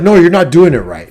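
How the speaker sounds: dad yelling. Retold or imitated. Dad yelling at his son over property work. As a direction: snap at the kid that he's doing it wrong. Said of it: "He'd just yell at me about it".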